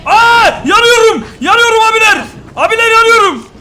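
high, loud panicked screams; panicked shouting. Pretend. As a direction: yell as if on fire. Character on fire in game.

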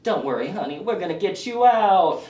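hammed-up southern accent; prestige-drama southern belle accent; grieving southern drawl. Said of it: "grief has somehow given him a southern drawl".

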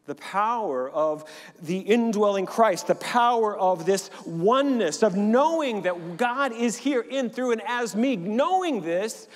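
loud, high, rising preacher's cry; preaching at full force. Acted. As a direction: build it up like a revival preacher.

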